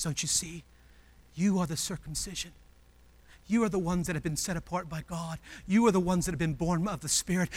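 hushed fatherly pleading; tender father. Acted. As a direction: reassure them gently, voice kept down. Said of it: "coming up to his kids".

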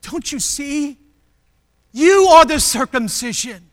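impassioned fatherly appeal, rising in force; pleading father. Acted. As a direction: plead with them, hitting YOU hard. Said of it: "like a father who comes up to his children".

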